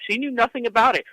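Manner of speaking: usual speaking voice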